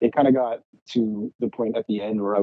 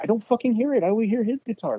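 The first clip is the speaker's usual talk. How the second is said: much higher-pitched, heated insistence; retold exasperated protest; 12 semitones higher than usual. Guitarist insisting to bandmates his part is missing, exasperated. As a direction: replay the row with an exasperated high voice.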